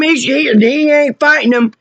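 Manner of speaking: normal talking voice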